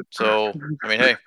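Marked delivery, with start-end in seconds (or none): none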